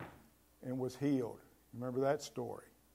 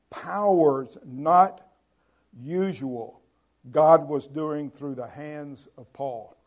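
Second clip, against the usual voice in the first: slow scripture reading; 6 dB louder, slower than usual. Real, not acted. Reads verse aloud slowly.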